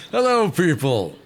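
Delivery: ordinary talk, nothing marked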